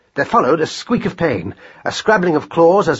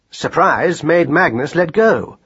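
usual speaking voice

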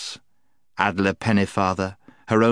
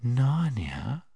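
sweet whisper; 6 semitones higher, 4 dB quieter than usual. Acted. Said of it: "speaking in a sweet, quiet voice".

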